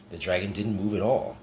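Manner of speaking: ordinary delivery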